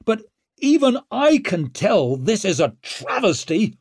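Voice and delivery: forceful public denunciation; calling out. A narrator's reading. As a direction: declare it across the courtroom. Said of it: "called Leo".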